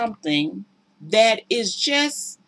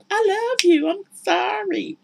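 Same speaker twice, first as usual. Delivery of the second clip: high, slow syrupy apology; mock sorry; 13 semitones higher than usual. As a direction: parody an empty apology. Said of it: "Abusive people love that".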